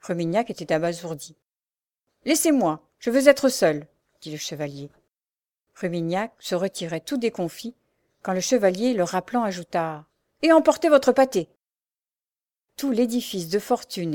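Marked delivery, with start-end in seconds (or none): 2.2-3.9 higher, louder, impatient dismissal; irritated order. A narrator's reading. Chevalier ordering the jailer out, irritated. 10.4-11.5 much higher, louder parting shot; indignant order. A narrator's reading. Chevalier calling after the retreating jailer, indignant. Prisoner calls jailer back, rejects pâté.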